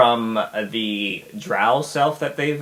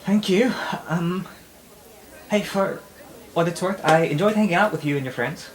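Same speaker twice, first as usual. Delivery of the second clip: shy character voice; 9 semitones higher than usual. Acted. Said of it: "I'll be like".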